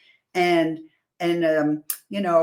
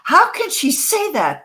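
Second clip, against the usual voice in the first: indignant critics; 9 semitones higher, 5 dB louder than usual. Retold or imitated. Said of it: "they might say".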